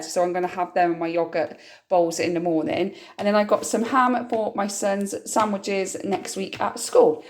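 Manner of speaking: ordinary talk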